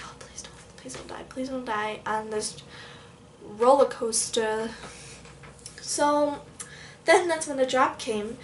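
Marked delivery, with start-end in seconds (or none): 0.1-4.7 hushed, pleading whisper; whispered prayer. Retold or imitated. Praying in her head on the coaster, whispering. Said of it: "I literally prayed in my head".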